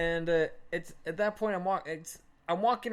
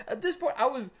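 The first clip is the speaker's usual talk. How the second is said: usual speaking voice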